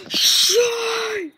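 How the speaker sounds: loud high call to the dog; shouting a name. Real, not acted. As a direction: yell the name out. Calling out to the dog.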